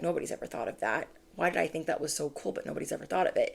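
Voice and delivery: quick, soft thinking aloud; private wondering. Acted. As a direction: toss it off softly like a passing thought. Acting listener's private wondering.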